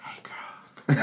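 breathy whispered greeting. Pretend. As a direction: whisper it like a secret. Whispered aside after catchphrase.

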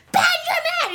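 very high screeched name; kid freaking out. Retold or imitated. Imitating Henry pointing at Ben, freaking out. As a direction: imitate a toddler's frantic shriek. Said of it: "he starts freaking out".

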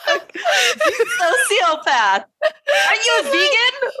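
high, slow, helpless laughing protest; squealed through real laughter; laughing mock outrage. Real, not acted. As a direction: accuse them while cracking up. Laughing outrage at the hammer joke.

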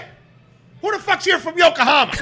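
very high, loud call-out; shout to the room. Retold or imitated. Chef calling out to whole bar, very high loud. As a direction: demand an answer from the whole bar. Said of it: "he's like".

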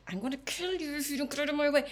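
mock rage. Voicing anger as an emotion.

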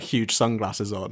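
ordinary delivery

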